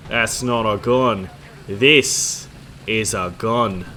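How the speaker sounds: slow, hammed-up Australian drawl; swaggering Australian accent, loud and unhurried; mock Australian accent. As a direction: draw it out in broad Australian. Mock Australian film-style line.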